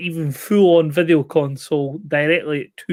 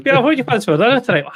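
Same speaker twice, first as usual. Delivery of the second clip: mock host; 7 semitones higher, much faster than usual. Mock takeaway question.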